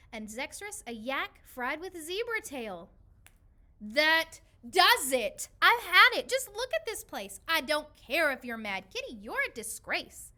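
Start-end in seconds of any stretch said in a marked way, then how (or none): 3.8-10.3 loud, higher, fed-up scolding; furious outburst. Acted. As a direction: blow up at the greedy cat. Owner loses temper with cat.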